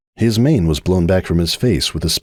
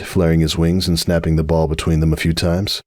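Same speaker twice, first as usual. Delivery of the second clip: ordinary delivery, nothing marked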